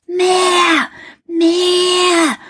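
put-on high, excited little-boy voice; Häwelmann shouting. A narrator's reading. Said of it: "schrie der Häwelmann".

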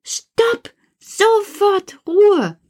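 very high shout, slow on Ruhe; calling out stop. Acted. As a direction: command the toys to freeze. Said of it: "Und rufe". (hoch gerufen, energisch, Ruhe gedehnt)

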